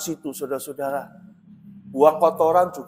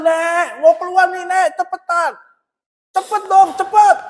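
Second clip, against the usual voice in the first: rising, higher and higher calls to hurry; urging her on, getting more impatient each time; impatient calling out; 11 semitones higher, 4 dB louder than usual. Retold or imitated. Pastor calling the neighbour grandma to hurry, impatient rising call. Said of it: "Saya panggil".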